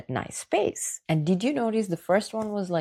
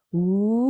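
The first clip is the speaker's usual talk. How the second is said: a single light siren slide; gentle siren woo; 3 semitones higher, 5 dB louder than usual. Shown as a demonstration. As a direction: glide the woo up, then down, smoothly. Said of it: "a gentle siren sound".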